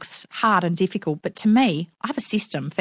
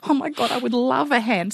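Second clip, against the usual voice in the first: higher-pitched, gushing imitation of relief; grateful relief; 6 semitones higher than usual. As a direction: accept help as the relieved woman, gratefully. Retold grateful acceptance of help.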